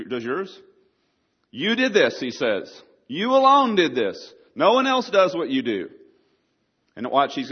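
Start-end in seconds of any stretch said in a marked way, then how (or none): 1.5-2.2 high, loud proclamation; emphatic declaration. Acted. Preacher voicing David's praise to God, emphatic. 3.1-5.9 high, ringing, loud proclamation; emphatic declaration. Acted. Preacher voicing David's praise to God, emphatic.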